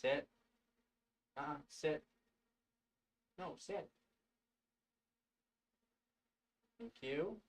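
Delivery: high soft coaxing pet voice. Real, not acted. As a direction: gently coax the cat to sit. Training cat to sit.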